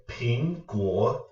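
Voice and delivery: "ping" rising, "guo" dipping then rising; second then third tone. Shown as a demonstration. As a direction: lift "ping", then drop "guo" and bring it back up. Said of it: "a second tone".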